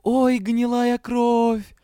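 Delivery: high, slow wail; moaned dismay. A narrator's reading. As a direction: groan it out long and high. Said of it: "простонала Найвери".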